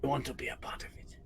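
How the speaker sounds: quick breathy whisper, almost unvoiced. Real, not acted. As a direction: drop to a fast whisper. Whispered aside after the accent.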